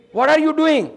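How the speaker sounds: loud, high shocked question; startled exclamation. Retold or imitated. Patient shocked when the healer bows at his feet, loud and high. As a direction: react as if someone suddenly bowed to you.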